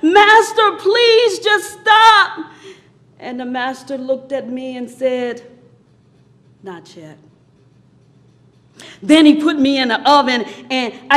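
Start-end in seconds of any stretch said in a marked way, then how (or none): 0.0-2.5 high, pleading yell; acted scream. Teacup begs the master on the spinning wheel, acted scream. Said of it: "I started screaming". 6.6-7.2 very low, barely audible reply; quiet refusal. Acted. Master answers the screaming teacup, quiet refusal.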